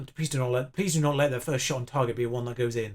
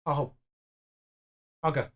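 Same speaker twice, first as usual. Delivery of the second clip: normal talking voice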